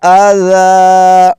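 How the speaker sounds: slow, high, melodic tilawat; Quran recitation. Shown as a demonstration. Teacher reciting the passage for students, slow melodic chant. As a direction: chant the verse in long drawn melody. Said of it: "یہ اس کا درست تلفظ ہے".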